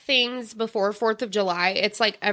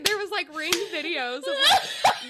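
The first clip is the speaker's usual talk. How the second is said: ordinary talk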